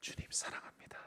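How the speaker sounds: barely audible unvoiced whisper; timid whisper. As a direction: confess it in a hushed, unsure whisper. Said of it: "자신 있게 말하지 못했을 겁니다". (자신 없이 속삭이는 고백)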